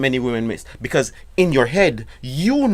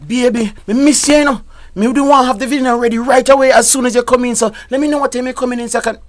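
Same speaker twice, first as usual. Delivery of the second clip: high, loud Jamaican patois sweet-talk; wife calling husband home for dinner, Jamaican patois; 11 semitones higher, 7 dB louder, faster than usual. Shown as a demonstration. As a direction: coax him in Jamaican patois. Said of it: "you can say it in patois".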